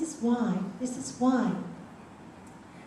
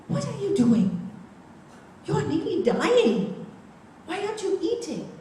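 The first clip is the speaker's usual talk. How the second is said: worried monk scolding; 8 semitones higher, 4 dB louder than usual. Acted.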